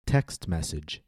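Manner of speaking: "Text message" with TEXT stressed, message falling; stress on first noun. Shown as a demonstration. Teacher leading listen and repeat, "Text message" stressed on TEXT. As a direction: hit "Text", let "message" fall away. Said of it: "paying careful attention to syllable stress".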